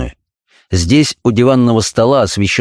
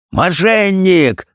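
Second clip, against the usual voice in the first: narrated yell; 10 semitones higher, much slower than usual. A narrator's reading. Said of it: "заорал он".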